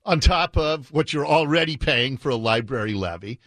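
ordinary delivery

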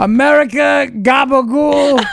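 slow, shouted gabagool in a Cuomo voice; Italian-American Cuomo voice. Retold or imitated. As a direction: ham up the New York Italian accent. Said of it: "Italian-American food names in his voice".

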